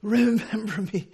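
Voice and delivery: acted struggle for air; last-breath effort. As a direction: force it out with your last breath. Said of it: "he used his last breath to say".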